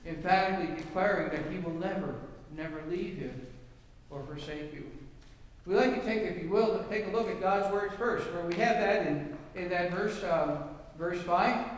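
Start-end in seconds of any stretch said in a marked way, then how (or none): none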